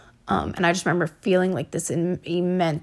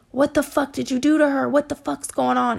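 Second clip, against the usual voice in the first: higher-pitched imitation of an alarmed yell; mock yell without full volume; retold yell; 6 semitones higher than usual. His mom demanding to know what he did, imitated yell. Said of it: "like, yell".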